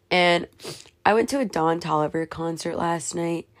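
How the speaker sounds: usual speaking voice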